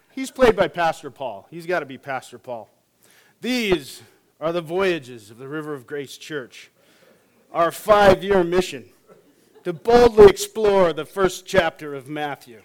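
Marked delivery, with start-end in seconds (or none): 3.4-12.6 hammed-up sci-fi announcer, slowing down. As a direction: boom it out like the show's opening. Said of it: "on an episode of Star Trek".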